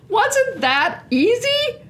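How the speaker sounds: high, slow, beaming question; delighted. Real, not acted. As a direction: gush about how easy it was. Delighted after the reveal.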